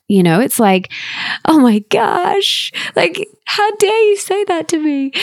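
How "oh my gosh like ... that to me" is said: pitched way up, shocked and offended; high, hurt indignation; indignant outburst. Retold or imitated. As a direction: squeal out a mock-offended how dare you. Outraged reply to "get over it.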